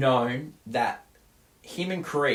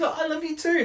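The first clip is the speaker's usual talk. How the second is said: very high imitated declaration of love; mock lovers' reply. Retelling the film's last lines, high imitation of a lover. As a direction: answer a sweetheart in a high voice. Acting the lovers' exchange at ending.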